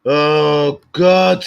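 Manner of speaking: loud, high, drawn-out groan of despair; exasperated cry. Real, not acted. Streamer despairing at the talk, exasperated cry. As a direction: wail it out in exasperation.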